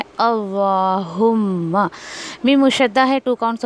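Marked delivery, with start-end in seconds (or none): 0.0-1.9 अल्लाहुम्मा with a full heavy lam, slow. Shown as a demonstration. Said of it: "इसको आपको पुर करके पढ़ना है।".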